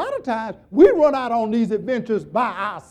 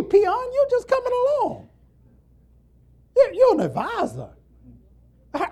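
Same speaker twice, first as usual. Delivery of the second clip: boastful general; 3 semitones higher, 3 dB louder than usual. Retold or imitated. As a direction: puff up like a proud four-star general. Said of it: "the problem would be ego".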